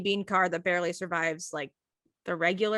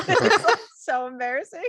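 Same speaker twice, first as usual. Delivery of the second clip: high, loud, laughing through the words; real laughter breaking into the sentence; 10 semitones higher, 6 dB louder than usual. Real, not acted. Teller recalls showing up uninvited, laughing hard. Laughing over own embarrassing story.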